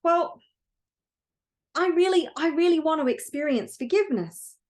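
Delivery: put-on high, pleading childlike voice; little light tells God what it wants, high and pleading; eager little light. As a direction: beg for it like a small eager spirit. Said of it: "still a bit confused and goes".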